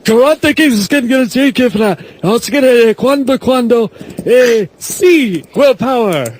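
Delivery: mock commentary in half-Spanish; John Laredo impression. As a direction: rattle off the call like an excited ringside announcer. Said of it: "that was John Laredo".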